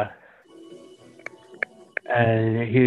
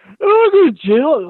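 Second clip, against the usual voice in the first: loud, much higher panicked pleading; 7 dB louder than usual. Retold or imitated. Retelling his panic over weed in his pocket, loud high panicked voice. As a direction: yelp it like the cops are already there.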